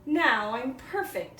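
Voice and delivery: loud, high proud boast; proud Coyote. Acted. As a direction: crow about it, full of himself.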